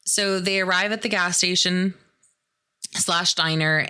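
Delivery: normal talking voice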